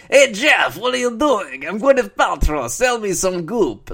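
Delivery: high, loud mock Transylvanian accent; Gwyneth Paltrow. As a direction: shout it in a silly Borat-like accent. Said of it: "that's just how she sounds".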